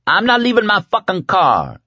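high, insistent outrage; furious. A narrator's reading. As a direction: refuse it in a high rage. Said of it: "bulging with fury".

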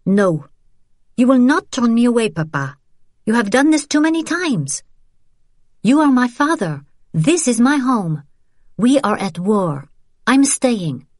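high, forceful refusal; defiant outburst. Acted. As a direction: refuse him, voice climbing high. Daughter refuses father, pitch high.

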